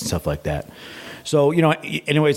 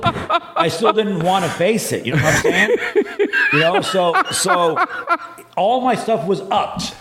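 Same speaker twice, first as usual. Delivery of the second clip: laughing; 10 semitones higher than usual. Real, not acted. Laughing about upped doses.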